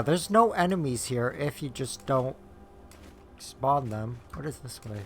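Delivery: usual speaking voice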